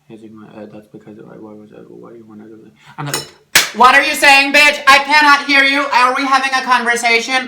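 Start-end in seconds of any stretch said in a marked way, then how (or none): 0.0-2.7 low, soft mock mumble; imitating poor talkers. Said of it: "they talk like this". 3.7-7.5 high, very loud indignant yell; exasperated shouting. Acted. Acted outburst at a mumbling talker.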